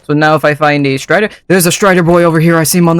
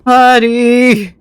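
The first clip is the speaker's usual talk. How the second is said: calling from far away; 7 semitones higher than usual. Real, not acted. Calling the Strider again.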